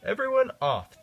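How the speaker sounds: conductor calling out. A narrator's reading. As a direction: call everyone off the train. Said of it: "the conductor said".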